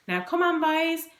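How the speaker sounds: put-on high, loud call to the boys; motherly chivvying. A narrator's reading. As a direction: hurry the children along. Mother hurrying boys to get ready.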